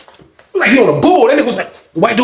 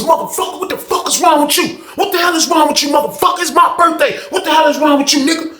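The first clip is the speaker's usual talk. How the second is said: higher yelled rage; furious yell; 7 semitones higher than usual. Retold or imitated. Predator yelling at John in the cell, furious. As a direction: yell it like he's losing it. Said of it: "All you heard was".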